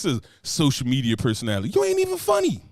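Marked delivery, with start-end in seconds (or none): 1.7-2.6 high, loud scornful put-down; mocking jab. Real, not acted. Roasting a social media personality, high scornful jab. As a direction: dismiss her with a high sneer.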